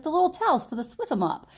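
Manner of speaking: high mock New York accent; tough-guy accent. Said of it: "He talks like this".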